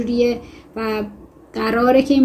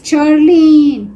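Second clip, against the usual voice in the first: loud, high, long-drawn roar of the name; narrated roar; 5 semitones higher, 9 dB louder, much slower than usual. A narrator's reading. Howard roars at Charlene across dinner, loud drawn-out roar. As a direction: roar her name, stretching it out. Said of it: "هوارد نعره کشید".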